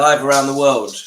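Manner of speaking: loud, playful announcement; excited. Acted. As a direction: call it out like a showman. Hyping a duet to viewers.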